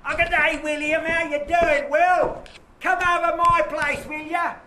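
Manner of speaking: very high, loud, hammed-up coarse woman; shrill-free but squawked high and loud, a crude lady calling William; mistress's voice. Teacher voicing the mistress calling William over, loud and high. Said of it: "I love to hear her speak".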